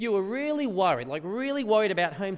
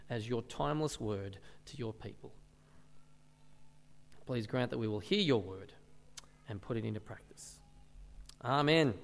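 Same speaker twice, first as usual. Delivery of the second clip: prayer; 4 semitones lower than usual. Acted. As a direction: pray earnestly and gently for the church. Leads the congregation in prayer.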